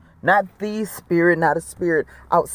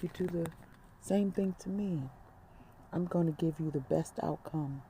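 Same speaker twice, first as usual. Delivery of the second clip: much softer, calm and gentle; quiet voice of Spirit; 15 dB quieter than usual. Retold or imitated. Spirit answering the worried mother, very soft and calm. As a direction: hush it down like a calm inner voice. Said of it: "Spirit said to me so quietly".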